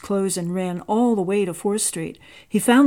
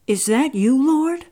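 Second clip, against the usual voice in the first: a higher, louder, startled question; awed, higher voice rising on Lord; 6 semitones higher, 4 dB louder than usual. A narrator's reading. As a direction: ask in amazement whether it's really the Lord. Carl hears God at night.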